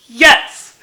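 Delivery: loud, high burst of joy; overjoyed outburst; triumphant shout. Real, not acted. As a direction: cheer it out in one yell. Triumphant reaction to book.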